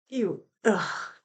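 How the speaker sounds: soft disgusted groan; exasperated ugh. Real, not acted. Reacting to Ruby's duck line in disgust. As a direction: give up on her with an ugh. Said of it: "exasperated groan".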